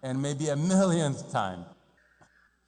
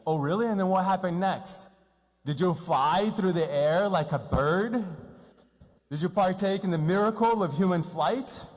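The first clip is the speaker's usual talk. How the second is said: mock amazement; 4 semitones higher than usual. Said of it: "And then he says".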